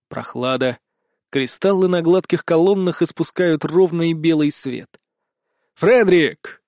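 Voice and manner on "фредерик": booming call; loud shout. Acted. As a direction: bellow the name into the vaults. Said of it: "Делаю возглас громче".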